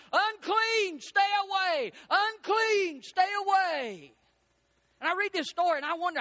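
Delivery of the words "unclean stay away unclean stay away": high, loud cry of unclean to warn people off; hollered warning. Retold or imitated. Preacher voicing a leper warning passersby away, loud high holler. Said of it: "you had to holler out".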